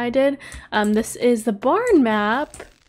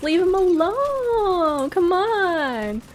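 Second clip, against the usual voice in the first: calling out; 7 semitones higher, much slower than usual. Real, not acted. Calling at the giant.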